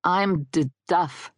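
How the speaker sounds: halting, stuttered self-introduction; stammering introduction. Acted. Dead guy introduces himself, stammering. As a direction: catch on the D of the name. Ghost stammers his own name.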